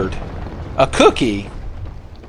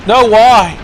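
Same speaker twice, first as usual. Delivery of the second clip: louder and much higher real protest; dismayed cry; 9 semitones higher, 8 dB louder than usual. Real, not acted. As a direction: yelp in dismay as the character falls. Falls off platform, cries out.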